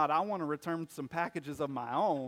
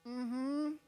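high, forced little mm-hmm; a hint of a boy faking he likes it; reluctant kid; 9 semitones higher than usual. Son pretending to enjoy potatoes for grandparents, high forced hum.

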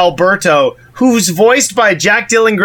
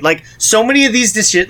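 normal talking voice

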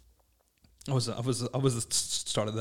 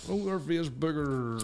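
high, very drawn-out greeting; Owen Rowe; 4 semitones higher, much slower than usual. Acted. Mocking an acquaintance's Facebook greeting, slow high impersonation. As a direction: stretch the words out in a high mimicking voice. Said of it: "Sound more like Owen Rowe".

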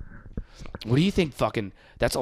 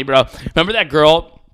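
ordinary talk, nothing marked

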